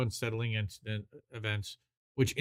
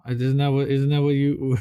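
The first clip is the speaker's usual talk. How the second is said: ordinary delivery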